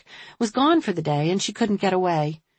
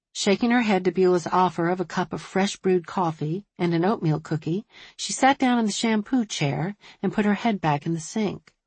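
plain reading voice

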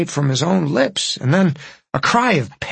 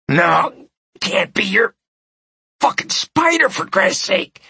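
much higher, strained disbelief; dying croak; 5 semitones higher than usual. Acted. Dying man refuses to believe it's Spider, croaking. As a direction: croak in pained denial. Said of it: "the dying man croaked".

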